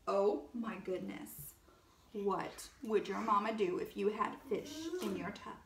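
usual speaking voice

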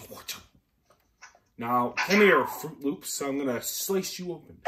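ordinary talk

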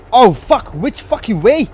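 loud, high, frantic yelling; panicked yell. Real, not acted. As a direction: yell while desperately looking for escape. Fleeing attackers in game.

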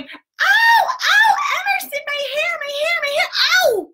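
high, loud, laughing cries of pain; hammed-up howl as your hair gets yanked; pained yell. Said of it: "I was going".

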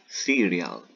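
"cereal" said English-style as "siɾjal"; English pronunciation. Shown as a demonstration. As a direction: show how "cereal" sounds in English. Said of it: "cereal se dice así". ("cereal" con pronunciación inglesa)

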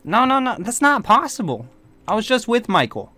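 stunned, protesting reply; shocked disbelief. Acted. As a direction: push the pitch right up in disbelief. Second character reacts in disbelief.

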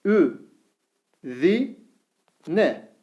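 Vü said with thin Ü, each syllable drawn out; syllable drill with thin vowel. Shown as a demonstration. Teacher reads the thin-vowel syllables to students, slowly. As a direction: read out each syllable apart. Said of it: "Ü sesi veriyoruz".

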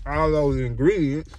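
talking with mouth full. Real, not acted. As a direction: speak through a bite of food. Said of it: "excuse me for talking with my mouth full".

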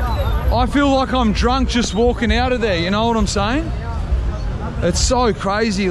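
ordinary talk, nothing marked

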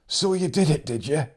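put-on an old farmer's cracked, teasing voice; old man teasing. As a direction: rib him like a wheezy old friend. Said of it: "He'd laugh that cracked laugh of his".

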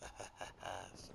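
unvoiced low sneaky whisper. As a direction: breathe it like the game voice. Echoing the game's whispered line.

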